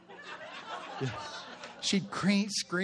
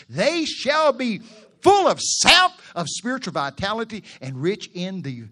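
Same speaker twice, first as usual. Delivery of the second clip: loud, high burst of preaching; preaching at full force; 6 semitones higher, 8 dB louder than usual. Acted. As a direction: lift the verse up and belt it. Preacher swells on the verse.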